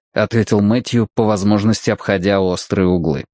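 ordinary delivery

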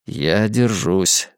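ordinary delivery

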